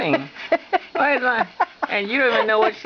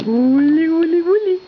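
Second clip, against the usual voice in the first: high playful coo, louder; 9 semitones higher, 5 dB louder than usual. Real, not acted. Dad coaxing the baby to eat, high sing-song.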